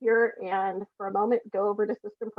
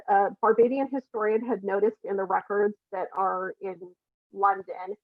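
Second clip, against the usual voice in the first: normal talking voice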